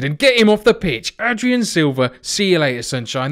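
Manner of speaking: high, loud heckle at a player; indignant shout. Real, not acted. Fan demanding a sending off after a bad tackle, shouting. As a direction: send him packing at the top of your range.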